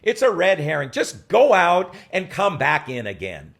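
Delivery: loud, slow brush-off; dismissive director. Retold or imitated. Director brushing off the actor's question, loud and slow. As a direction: wave it off loudly. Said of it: "he said".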